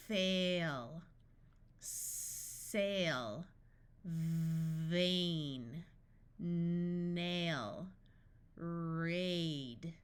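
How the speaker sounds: first consonant held long, then gliding into the vowel; drawn-out phonics blending; sounded out. Shown as a demonstration. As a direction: stretch each word slowly through its sounds.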